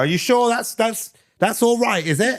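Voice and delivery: high, slow put-on voice of a doubtful coworker; imitating Justin. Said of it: "he'll go".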